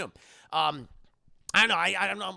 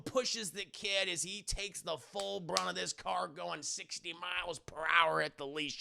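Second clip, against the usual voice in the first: ordinary talk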